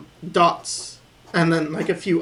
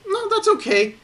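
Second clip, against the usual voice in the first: very high, light self-reassurance; high-pitched "no worries" to yourself; accepting self-talk; 14 semitones higher than usual. Acted. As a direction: imitate a forgiving inner voice, pitched way up. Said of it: "But if I think".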